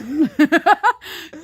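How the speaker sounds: loud laugh at forgetting a word; embarrassed laugh. Real, not acted. Can't recall the square's name.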